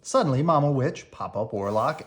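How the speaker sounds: usual speaking voice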